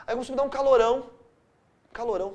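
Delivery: ordinary talk, nothing marked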